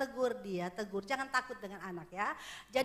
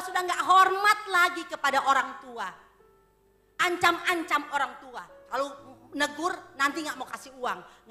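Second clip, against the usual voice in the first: loud, higher, driving sermon voice; preaching at full force; 6 semitones higher, 10 dB louder than usual. Acted. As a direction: thunder the warning at the congregation. Preaching climax to congregation.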